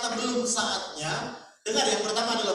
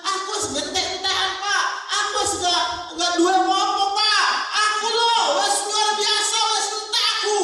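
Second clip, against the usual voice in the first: much higher and louder, whining lament; hammed-up wailing complaint; 11 semitones higher, 6 dB louder than usual. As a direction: overdo the moaning like it's the end of the world. Orang lebay mengeluh habis. (jemaat lebay merengek keras, merasa sudah habis)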